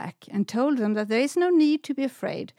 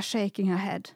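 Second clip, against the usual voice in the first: plain reading voice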